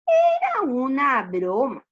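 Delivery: loud, slow, drawn-out reveal; prankster shepherd. Acted. Shepherd reveals the joke to villagers, teasing. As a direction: stretch out the confession teasingly. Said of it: "dijo el pastor".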